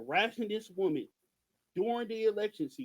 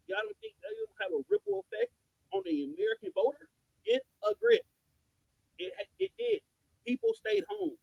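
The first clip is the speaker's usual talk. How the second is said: much higher, pressing build; heated rant; 8 semitones higher than usual. Real, not acted. Speaker lecturing followers on lost votes, high and heated. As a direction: pile on the numbers.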